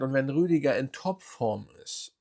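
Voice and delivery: ordinary talk, nothing marked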